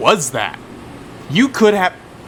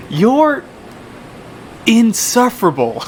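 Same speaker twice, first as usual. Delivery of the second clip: teasing exasperation; 9 semitones higher, slower than usual. Acted. Teasing rival.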